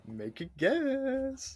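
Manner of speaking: high, slowly stretched playful chant; high drawn-out prodding. Pretend. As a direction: prod them in a soft, stretched-out high voice. Teasing chant pressing co-host to guess.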